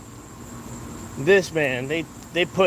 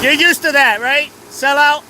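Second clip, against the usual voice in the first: high, loud sneering taunt; loud mocking heckle; jeering shout; 6 semitones higher, 5 dB louder than usual. Real, not acted. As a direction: needle him loudly. Heckling the officer.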